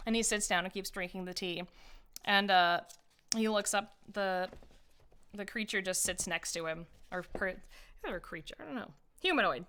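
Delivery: usual speaking voice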